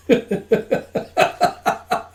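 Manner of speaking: high, airless giggle; wheezing with laughter; breathless laugh. Real, not acted. Laughter after the bear line.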